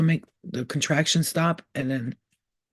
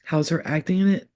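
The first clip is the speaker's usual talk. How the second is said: usual speaking voice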